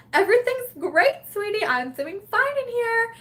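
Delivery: high singsong sweetness covering panic; fake cheerfulness. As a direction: reassure your partner far too brightly. Pretending all is fine to husband.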